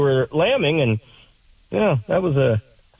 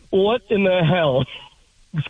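laughing while talking; 5 semitones higher than usual. Real, not acted. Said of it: "I'm just dying laughing".

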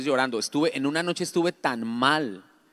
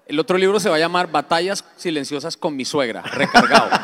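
louder, laughing punchline; laughing joke; 3 semitones higher, 8 dB louder than usual. Real, not acted. Pastor joking about a sequel book with his mother-in-law, laughing. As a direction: crack the sequel title through laughter.

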